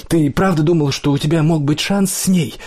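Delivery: high-pitched, mocking disbelief; mock him as if the idea were absurd; snorting scorn. A narrator's reading. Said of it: "Он фыркнул".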